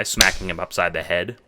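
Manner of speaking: ordinary delivery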